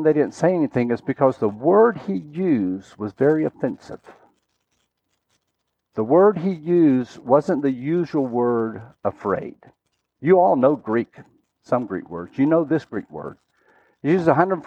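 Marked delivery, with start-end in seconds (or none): none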